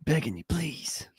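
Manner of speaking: slow pleading whisper; begging whisper. Acted. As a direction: whisper a desperate plea, slowly. Mock begging bit.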